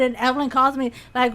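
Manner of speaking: normal talking voice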